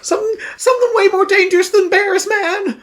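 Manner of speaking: very high, alarmed dude voice; panicky young dude. Acted. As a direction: warn him like a frantic slacker. Young dude warns of monsters.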